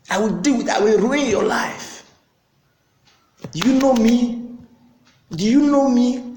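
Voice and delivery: arrogant boast. Retold or imitated. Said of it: "Pride, boastfulness".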